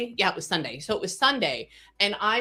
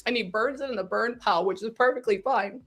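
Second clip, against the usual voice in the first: real welling-up, voice climbing high; getting emotional; 6 semitones higher than usual. Real, not acted. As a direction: tell it through rising emotion, pitched high. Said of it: "obviously I'm getting emotional".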